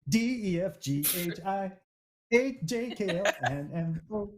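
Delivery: put-on young pop singer's alphabet, sung in short bursts. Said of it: "as if you're [name]".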